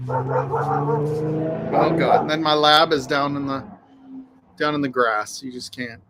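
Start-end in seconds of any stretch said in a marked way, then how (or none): none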